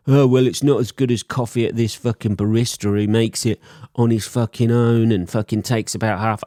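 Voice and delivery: hammed-up coffee snob; mock coffee snob. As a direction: scoff at my cheap coffee like a barista purist. Mocking imagined coffee snob.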